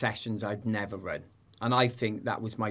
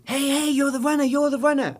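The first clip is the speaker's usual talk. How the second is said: much higher and louder mock call; calling out to him; 5 dB louder than usual. A stranger in Gateshead calls out to the athlete, high and loud. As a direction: hail him like an excited fan in Gateshead. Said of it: "they said".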